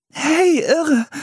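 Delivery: put-on very high girlish shout; calling out. A narrator's reading. Girl greeting the settlers, calling out high. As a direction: call out brightly in a high child's voice. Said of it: "rief Anne".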